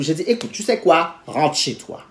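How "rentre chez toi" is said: low, soft retold order; quiet dismissal. Telling her date to leave, quiet low dismissal. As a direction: send him off softly and low. Sends date home, first take.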